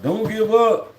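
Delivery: exasperated mock quote of "Don't give up"; scornful mock. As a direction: throw their line back at them, mocking. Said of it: "So what I'm gonna do, just die if I give up?".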